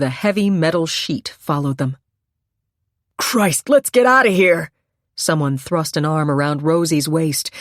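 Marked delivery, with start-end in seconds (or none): 3.2-4.7 urgent shout. A narrator's reading. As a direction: shout to get everyone moving. Panicked bystander urging escape.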